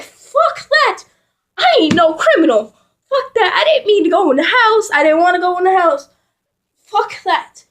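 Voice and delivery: high, rapid-fire protest; indignant high-pitched rant; frantic refusal. Retold or imitated. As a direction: refuse in a rising panic, words tumbling out. Frantic refusal of sister's escape plan.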